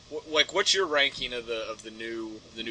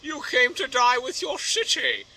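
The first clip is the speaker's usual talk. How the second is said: higher, drawn-out villain voice; slow, raised-pitch villain taunt; Bane; 8 semitones higher, 4 dB louder, slower than usual. Acted. Bane greets Batman in the film, higher villain voice. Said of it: "And Bane's like".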